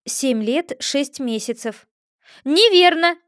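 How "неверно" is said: loud, high crowing cry; gleeful gotcha shout; triumphant exclamation. A narrator's reading. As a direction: crow it out in triumph. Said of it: "торжествующе воскликнул болванчик".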